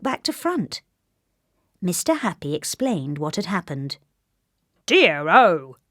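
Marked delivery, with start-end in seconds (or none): 4.9-5.8 loud mild dismay; exclaiming. A narrator's reading. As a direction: blurt it in dismay. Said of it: "he exclaimed".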